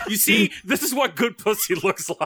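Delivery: lilting, sung-out boast; sing-song boast. Pretend. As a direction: croon it like a proud reveal. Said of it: "and go".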